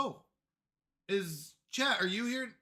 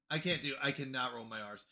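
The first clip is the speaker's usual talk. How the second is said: ordinary talk, nothing marked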